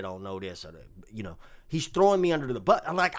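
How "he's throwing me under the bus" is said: normal talking voice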